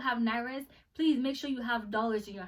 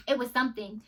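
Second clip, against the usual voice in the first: ordinary talk, nothing marked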